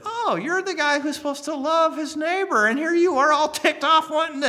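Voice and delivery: the Lord rebuking. Retold or imitated. The Lord scolding the preacher for his anger, mock rebuke. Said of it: "it was like the Lord said".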